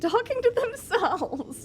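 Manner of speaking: high, drawn-out words dissolving into laughs; breaking into laughter. Real, not acted. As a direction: let the laugh take over the last words. Laughs while reading out chat.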